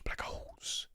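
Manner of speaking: hushed whispered echo. Real, not acted. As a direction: drop right down to a whisper. Whispered echo of his own words.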